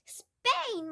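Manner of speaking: high, loud dismayed cry; horrified at the news. A narrator's reading. Matilda protesting to her father, high dismayed cry. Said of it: "Matilda cried".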